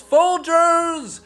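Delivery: usual speaking voice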